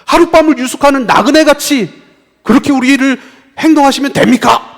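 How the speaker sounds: high, loud outcry to God; indignant reproach. Acted. People protesting being treated like strangers, loud and indignant. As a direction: protest at full voice.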